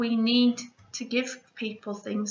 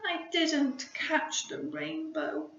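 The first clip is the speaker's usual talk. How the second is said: slow, small disappointed voice; a little creature let down; sad; 6 semitones higher, slower than usual. Acted. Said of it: "said Pip sadly".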